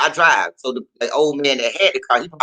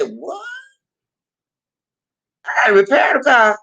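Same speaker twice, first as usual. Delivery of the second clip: high, stunned what; shocked disbelief; 11 semitones higher than usual. Retold or imitated. To the mechanic, hearing she must pay, shocked and high. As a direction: squeak out the shock.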